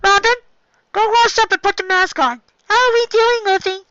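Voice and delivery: high, loud doctor orders; high and loud, busy and cheerful; loud Chopper. Acted. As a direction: call out brightly as the little doctor.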